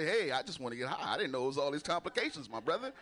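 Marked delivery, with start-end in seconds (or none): none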